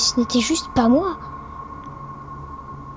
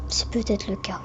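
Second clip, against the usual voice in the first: whispering; 3 semitones lower, 8 dB quieter than usual. Acted. Creepy intro, eerie hushed aside.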